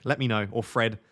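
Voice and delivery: ordinary delivery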